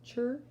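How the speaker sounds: "chur" said alone, rising at the end; ending sound chur. Shown as a demonstration. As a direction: isolate "chur" like the end of catcher. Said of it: "So you can hear catcher".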